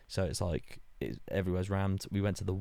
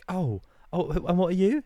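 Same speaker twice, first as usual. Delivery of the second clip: higher, feigned innocence; mock innocent question; 11 semitones higher, 6 dB louder than usual. Retelling himself playing innocent to the mouse girl, higher. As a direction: ask it all fake-innocent. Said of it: "I was like".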